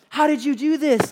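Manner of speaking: high, loud astonished woman; acted awestruck question; mock amazement. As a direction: marvel at a miracle, hammed-up. Woman amazed by imagined miracle.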